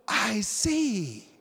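gasp, then drawn-out wonder; sudden mock awe, stretched out; acted amazed realization. Patient suddenly seeing clearly, acted amazement.

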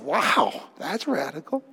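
low and soft, mildly amazed; a quiet impressed aside; hushed amazement. Retold or imitated. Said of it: "I said".

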